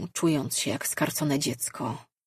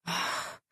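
soft, breathed-out "ach"; soft sigh; 8 dB quieter than usual. A narrator's reading. Arley softens toward the man in love, sighing. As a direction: let out a quiet sigh. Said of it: "i westchnęła".

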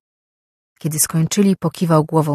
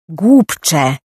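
a sudden, loud furious roar; 7 dB louder than usual. A narrator's reading. Overseer roaring at the clumsy slave, loud and furious. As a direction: bellow the insult at him. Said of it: "ryknął znajomy głos".

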